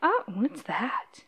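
slow intrigued whisper; whispered curiosity. Acted. Sandra whispering to Billiam about the Charleston. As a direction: lean in and whisper the question.

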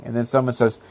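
usual speaking voice

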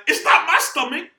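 high, loud comic exasperation; worked-up mock complaint; exasperated outburst. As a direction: shout it like you're fed up with being asked. Acted exasperation at being asked.

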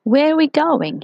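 high, breathless gingerbread man; small cookie, out of breath and eager; breathless high little voice. A narrator's reading. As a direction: gasp out the question in a high little voice. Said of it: "he asked breathlessly".

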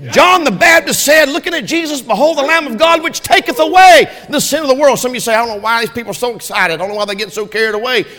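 loud, high preacher's cry; full-force preaching. Acted. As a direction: shout the gospel to the rafters.

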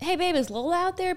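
high-pitched mock yell to partner; retold yell. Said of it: "I yell at Jordan".

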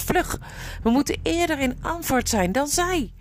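high-pitched, pressing call to hurry; urgent urging. A narrator's reading. Winne urges the others to outrun the army, urgent. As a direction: urge them on in alarm.

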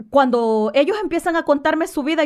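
ordinary talk, nothing marked

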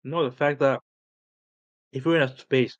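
talking around a hard candy; words round a sucked candy; candy in mouth. Real, not acted. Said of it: "sorry, I got candy in my mouth".

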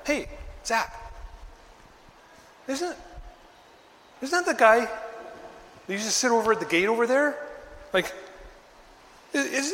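a bystander nudging his friend, lightly voiced; lightly voiced townsman asking a friend; curious onlooker. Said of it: "can't you imagine that".

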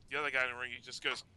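normal talking voice

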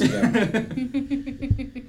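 put-on slow, high grumpy old man; crotchety old man grumbling. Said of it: "old grumpy crotchety Stefan".

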